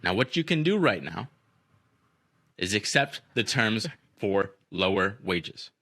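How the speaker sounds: slow, deliberate threat with long pauses; measured retold ultimatum; acted menacing speech. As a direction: play the intimidating boss, letting each phrase hang. Retelling a boss's menacing speech.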